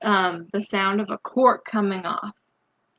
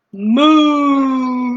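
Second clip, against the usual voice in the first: big cow moo; 3 semitones higher, 7 dB louder than usual. Shown as a demonstration. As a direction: give your best cow moo. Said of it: "Let's hear your best, your best moo".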